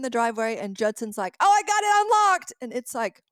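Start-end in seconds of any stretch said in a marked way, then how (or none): 1.4-2.5 proud announcement. Retold or imitated. Judson proudly announcing he opened it.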